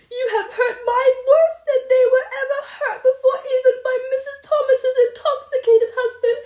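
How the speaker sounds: shrieked high and loud, sobbing through it; a hurt child screaming every word; tearful raging tirade. Acted. Insulted girl vowing never to forgive, sobbing furious shrieks.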